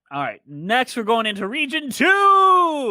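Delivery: loud, drawn-out rising announcer call; big, slow build to a held shout; hyped announcement. Pretend. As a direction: shout it like a ring announcer, stretching the end. Hyped announcement of the next region.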